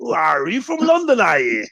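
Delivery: high-pitched mock local voice; hammed-up nosy locals, high questions dropping on "are you"; excited locals. Locals quizzing a visiting Londoner, high mocking voice. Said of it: "and they're like".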